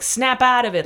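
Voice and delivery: loud mock rebuke to her own soul. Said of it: "like [name] in, in Moonlight".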